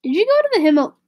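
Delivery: ordinary delivery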